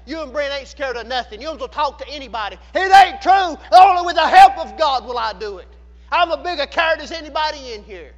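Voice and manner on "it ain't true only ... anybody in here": loud preacher's cry; full-force preaching. Real, not acted. Preacher denies he is fearless, in a loud preaching shout. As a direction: shout the denial from the pulpit.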